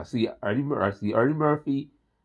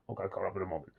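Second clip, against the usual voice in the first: much softer, under the breath; sheepish mumble; 6 semitones lower, 15 dB quieter, much faster than usual. Real, not acted. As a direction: mumble it to yourself. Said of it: "No, no, I'm sorry".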